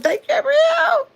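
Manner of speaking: squeaky high thank-you. Pretend. Thanking Abrielle for the gift in a squeaky high voice.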